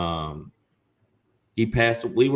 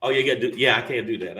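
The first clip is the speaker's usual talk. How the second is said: ordinary talk, nothing marked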